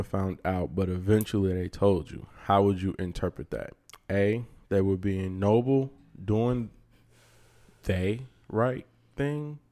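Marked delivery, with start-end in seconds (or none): 4.1-9.6 slow stumbling read aloud; slow halting reading. Real, not acted. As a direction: read it slowly, sounding out words. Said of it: "Oh, he's reading".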